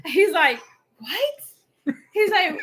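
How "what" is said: high-pitched mock disbelief; baffled. Husband hears wife's accusation, imitated bafflement. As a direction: imitate him caught completely off guard.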